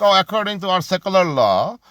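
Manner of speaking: high, mock-polite official voice; sneering mock bureaucrat, pitched well up. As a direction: ham up a smug official letting the rich go. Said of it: "They're like".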